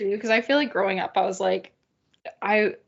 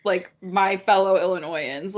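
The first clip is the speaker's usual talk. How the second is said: ordinary talk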